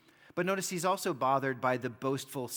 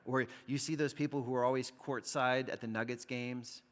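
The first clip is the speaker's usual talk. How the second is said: ordinary talk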